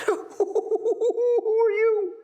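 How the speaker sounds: very high, slow squeak; a hammed-up scared boy voice; squeaky frightened boy. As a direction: squeak it out in mock terror. Boy's frightened question, laughing.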